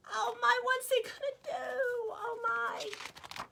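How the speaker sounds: high fearful cry; worried cry. Acted. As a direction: fret for the trapped friends. Narrator fears for friends.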